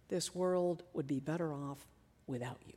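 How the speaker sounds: very low, hushed inner voice; barely voiced, dark and sinking; voice of condemnation. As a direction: say it low and soft, pausing before the end. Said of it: "This is the voice that can even say".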